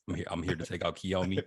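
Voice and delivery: soft, timid stammer; a scared teen stammering at the door; nervous stutter. Pretend. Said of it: "He said, We at the door stuttering like".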